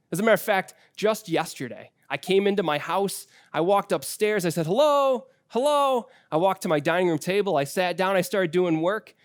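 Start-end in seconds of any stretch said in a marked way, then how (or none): 4.6-6.1 high, loud sing-song hello; bright call up the stairs. Retold or imitated. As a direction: call into the house to announce yourself. Calling out on coming home.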